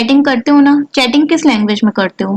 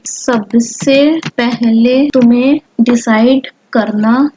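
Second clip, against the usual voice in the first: slow, word by word dictation; slow typing-along dictation; 2 semitones lower, much slower than usual. Acted. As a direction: speak each word slowly as you type it.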